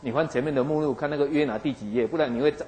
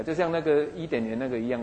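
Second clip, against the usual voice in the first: usual speaking voice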